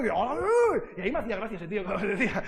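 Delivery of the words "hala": comic drawn exclamation, imitating him; old man's catchphrase. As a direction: do the funny man from TV. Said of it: "¿Habéis oído ese hombre?". (imitando la exclamación cómica de un señor)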